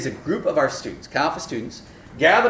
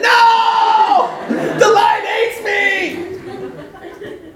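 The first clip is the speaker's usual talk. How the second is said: high, loud, very slow laughing wail; wailing son; 8 dB louder, much slower than usual. Retold or imitated. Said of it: "he's like".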